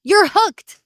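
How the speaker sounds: gasping, then high and thrilled; excited gasp. Real, not acted. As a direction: squeal with delighted surprise. Friend reveals she loves show.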